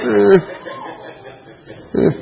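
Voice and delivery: short high forced sobs, badly faked; mock crying. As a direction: squeeze out token cries like a kid. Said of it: "Okay, you can cry for".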